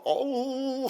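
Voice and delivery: imitated high wolf howl. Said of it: "늑대들이 막".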